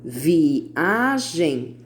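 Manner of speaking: "Viagem" stretched as vi, a, gem; three drawn-out beats on "Viagem"; syllable by syllable. Shown as a demonstration. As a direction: sound out each syllable of "Viagem". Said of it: "três sílabas".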